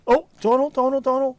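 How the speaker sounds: high, loud excited calls of the name; excited cry. Real, not acted. Streamer cheering on Donald in the game, excited high shouts. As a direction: cheer him on at the top of the voice.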